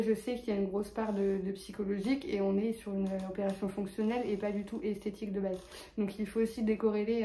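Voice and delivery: normal talking voice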